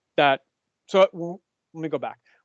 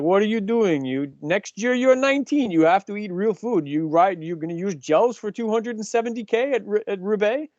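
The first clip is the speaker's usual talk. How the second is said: higher-pitched, hammed-up Polish accent; comic Polish-accented telling-off, pitched up; Robert; 6 semitones higher than usual. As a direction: scold the kids in a badly faked Polish accent. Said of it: "I can't do his Polish accent".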